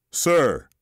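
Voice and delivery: one word that demands an answer; authoritative. Acted. Officer pressing Teddy for an answer, firm and authoritative. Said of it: "the officer says authoritatively".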